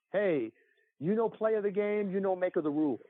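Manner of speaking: dad's hammed-up Italian accent; mock Italian accent, jokey; horrible Italian accent. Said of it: "in a horrible Italian accent".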